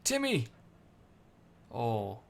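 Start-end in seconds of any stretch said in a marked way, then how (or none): 0.0-0.5 calling out. Acted. Calling for the cat.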